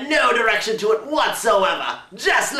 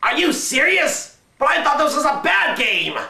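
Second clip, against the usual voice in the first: incredulous; 7 semitones higher than usual. Acted. Shocked reaction to praise.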